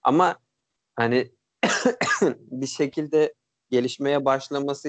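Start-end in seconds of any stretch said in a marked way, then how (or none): 1.6-2.4 short cough that falls away. Real, not acted. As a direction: cough once, then carry on. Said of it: "Çok öksürüyorum ama kusura bakmayın".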